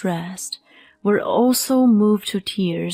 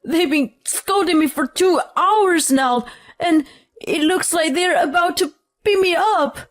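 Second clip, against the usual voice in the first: high-pitched, frantic pleading; urgent shout; 8 semitones higher than usual. A narrator's reading. Husband begs his wife to rescue him from her family, urgent. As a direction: cry out urgently, pitch raised throughout. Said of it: "he urgently shouted".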